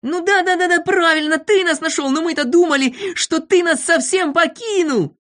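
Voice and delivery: high, gushing group voice; effusive high chatter; excited agreement. A narrator's reading. Friends excitedly agree at reunion.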